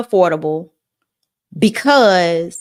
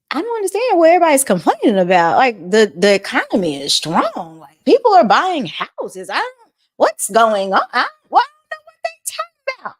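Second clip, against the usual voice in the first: imitating Biden; 5 semitones higher than usual. Vlogger mocks President Biden, baffled, in a high fast put-on voice. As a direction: play a confused president, rushing and fading out. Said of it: "President Biden say something along the lines of".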